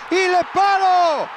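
loud stretched shout; excited shout. Real, not acted. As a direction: yell it as the ball hits the post. Ball hits the post.

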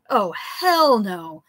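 slow, loud Oh hell no; loud outburst. Acted. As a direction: blurt it out in disgust. Narrator sees him retch.

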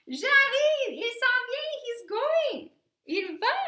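very high, loud reply; shout. A narrator's reading. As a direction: yell back eagerly. Sacha runs to swim.

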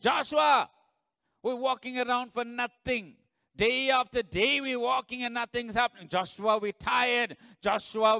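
loud, high whining shouts of Joshua; complaining crowd. Acted. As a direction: moan at the leader, calling him again and again. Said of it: "because they would have said".

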